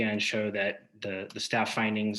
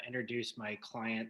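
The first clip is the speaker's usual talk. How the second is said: faint, far from the mic; words fading out, hard to hear; barely audible; 10 dB quieter than usual. Real, not acted. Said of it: "can you speak up".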